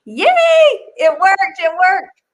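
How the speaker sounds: bright excited cheer; happy yay. Real, not acted. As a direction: celebrate that it finally worked. Video finally plays after long wait.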